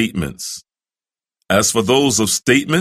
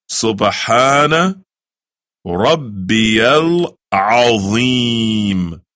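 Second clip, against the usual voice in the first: slow, drawn-out Arabic recitation; much slower than usual. Shown as a demonstration. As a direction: stretch every syllable of the prayer. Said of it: "you say".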